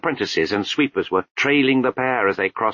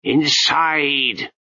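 roared; 6 semitones higher, 4 dB louder than usual. A narrator's reading. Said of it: "Lutzer roared".

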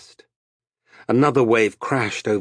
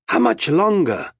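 raised, high question; shouted; 8 semitones higher, 5 dB louder than usual. A narrator's reading. Arutha to the captain after sleepless days, shouted. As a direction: call it over the wind. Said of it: "Arutha shouted".

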